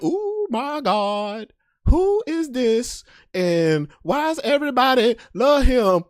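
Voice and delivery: high, slow amazement; stunned disbelief. Retold or imitated. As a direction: drag out the shock. Stunned by opponent's popularity.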